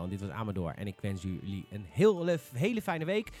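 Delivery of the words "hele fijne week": ordinary talk, nothing marked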